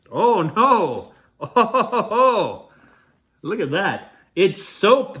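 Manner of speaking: high, loud cry of dismay; dismayed shout. Acted. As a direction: shout in horror at the soap. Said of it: "they both shouted".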